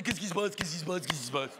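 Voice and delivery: lightly voiced fish, puzzled, trailing downward; astonished fish. Fish surface to see the beauty, mildly voiced bafflement.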